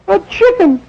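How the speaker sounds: high falsetto disbelief; disbelieving wife. Acted. Wife repeats the chicken to husband, high disbelief. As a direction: echo it in stunned falsetto.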